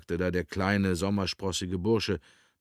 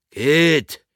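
loud, higher yell; forceful one-word bellow; shouted command; 7 semitones higher, 10 dB louder than usual. A narrator's reading. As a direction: yell to drive them on. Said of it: "schrie der Mann mit der Narbe".

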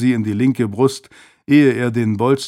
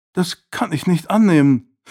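half-hearted protest; 6 semitones higher than usual. A narrator's reading. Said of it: "protestierte sie halbherzig".

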